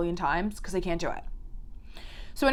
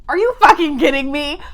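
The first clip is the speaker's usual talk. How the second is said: loud, high yell of disbelief; outraged shout; 6 semitones higher, 10 dB louder than usual. Real, not acted. Outraged reaction to the statue.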